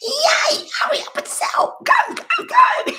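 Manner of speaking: very high, loud squealing cheer; wildly excited celebratory yelling; gleeful cheering. Acted. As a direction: whoop it out, breaking into laughter. Celebrates the neighbour's plan to move.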